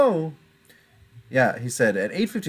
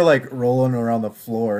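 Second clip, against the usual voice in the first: ordinary talk, nothing marked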